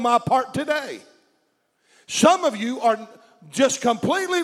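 fiery preaching at full force. Acted. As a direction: preach it loud and rising.

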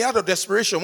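ordinary talk, nothing marked

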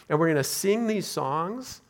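normal talking voice

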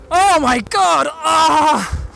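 high startled outcry; shocked cry. Real, not acted. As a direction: recoil at the gruesome find. Discovers corpse, cries out.

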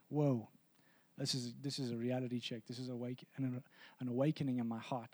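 hushed, low voice of someone struck; sobered realization. Acted. Listener taking in the message, hushed and sobered. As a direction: say it softly and low, as if just waking up to it.

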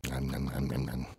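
soft mock chomping noises; munch away quietly on make-believe food; pretend munching. Character digging into a hot dog, pretend munching. Eating hot dogs in the skit.

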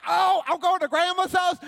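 put-on high, loud burst of childish glee; excited child. Boy told he's going to Grandma's house, thrilled. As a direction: shout it like an overjoyed little kid.

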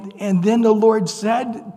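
ordinary talk

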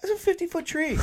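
high, incredulous mock outburst; hammed-up amazement. Voicing amazed onlooker at fallen tree.

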